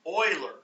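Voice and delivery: "Euler" said as OY-ler, the German way; correct pronunciation. Shown as a demonstration. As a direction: say "Euler" like "oiler". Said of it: "is how you pronounce that".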